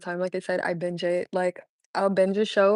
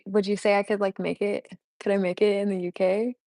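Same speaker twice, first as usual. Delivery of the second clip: usual speaking voice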